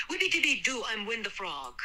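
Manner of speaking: nasally, high-pitched goofy voice; silly nasal celebrity imitation; Tom Hanks. A narrator's reading. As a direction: pitch it up through the nose. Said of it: "in a nasally Tom Hanks-esque voice".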